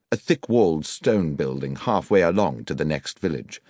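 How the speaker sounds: ordinary delivery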